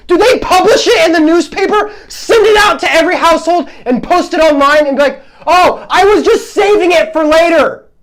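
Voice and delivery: mock the lame excuse, all heated up; fired-up, incredulous ranting. Real, not acted. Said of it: "Got a little heated there".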